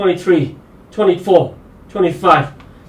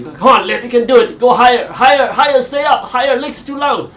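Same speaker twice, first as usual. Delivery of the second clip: higher, urging coach voice; imitating yoga coach; 7 semitones higher than usual. A hint of his yoga coach urging him higher. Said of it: "my yoga coach tells me".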